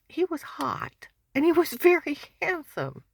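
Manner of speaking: high, loud and gushing. Real, not acted. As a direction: swoon over him. Gushing, laughing about boss.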